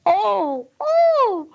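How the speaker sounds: very high, loud yelp of dismay; startled cry. A narrator's reading. Wife remembers husband's gift, high loud startled cry. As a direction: leap up crying out like a singed cat. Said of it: "leapt up like a little singed cat and cried".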